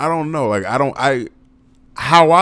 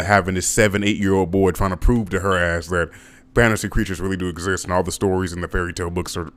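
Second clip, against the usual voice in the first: normal talking voice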